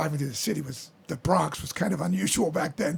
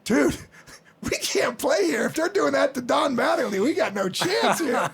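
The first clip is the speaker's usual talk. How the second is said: higher, slow, stunned; mock dismay; 7 semitones higher, 3 dB louder than usual. Teammate Dave reacting to fans booing, higher stunned dismay. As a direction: say it like you just saw your future. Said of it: "Dave Island looked at me and goes".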